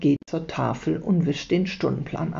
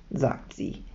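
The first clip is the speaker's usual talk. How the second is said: usual speaking voice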